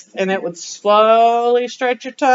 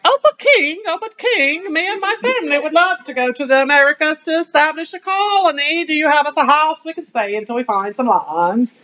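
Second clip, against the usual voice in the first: high, fawning plea to the king; butter up the King in a squeaky voice; mock pleading to king; 6 semitones higher than usual. Student playing colonist begs the King, in a high fawning voice. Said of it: "and you're like".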